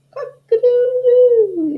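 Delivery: high loud crowing; rooster crow. Acted. Fowl crowing in the night barn, loud crow. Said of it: "who crowed".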